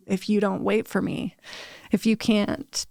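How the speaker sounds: ordinary talk, nothing marked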